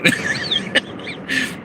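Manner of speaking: soft, voiceless chuckle; quiet leftover giggle; real laughter. Real, not acted. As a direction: keep chuckling after trying to move on. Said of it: "I'm laughing about it".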